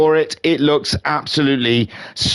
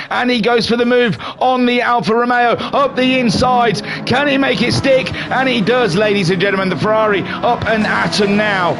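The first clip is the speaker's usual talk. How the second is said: race commentary; 7 semitones higher than usual. Acted. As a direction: call the race start like a hyped commentator. Live race play-by-play commentary.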